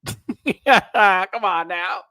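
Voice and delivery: drawn-out high laughing cry on Come; laughing wail. Shown as a demonstration.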